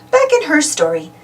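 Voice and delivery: plain reading voice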